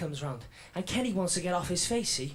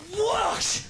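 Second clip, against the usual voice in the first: whoosh sound effect; 11 semitones higher, 6 dB louder than usual. Retold or imitated. Police rush at him.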